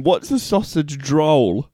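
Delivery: put-on "sausage roll" drawn out slowly in a light Kiwi accent; lightly ape a Kiwi saying "sausage roll"; mock Kiwi accent. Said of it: "take the mick out of my".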